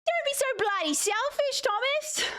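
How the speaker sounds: high-pitched, exaggerated Aussie movie accent; mock Australian movie accent. Streamer mimicking an Australian movie line she hates, high mock Aussie accent. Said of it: "I hate Australian accents in movies".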